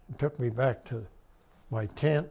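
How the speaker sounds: normal talking voice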